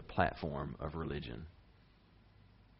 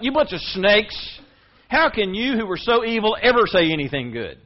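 angry rebuke; 10 semitones higher, 11 dB louder than usual. Retold or imitated. Preacher voicing Jesus rebuking the Pharisees, loud angry shout. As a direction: blast them like Jesus scolding hypocrites. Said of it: "turn on them and say".

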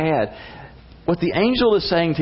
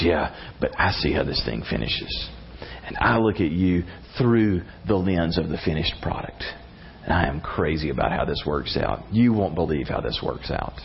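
tender God; 9 semitones lower, 4 dB quieter than usual. Acted. God answers our weakness.

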